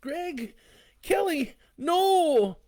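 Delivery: high, loud desperate shouts; husband screaming at his wife to stop; panicked yell. A narrator's reading. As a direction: scream their names in terror.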